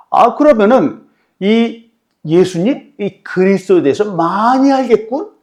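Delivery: slow, high sneering voice; sarcastic mockery. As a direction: drag it out to mock him. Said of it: "이렇게 또 비꼬면서 얘기하는 거예요".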